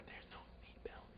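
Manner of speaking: tiny whispered aside, imitating a friend; worried whisper. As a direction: whisper it nervously from the back. Friend's scared aside in back seat.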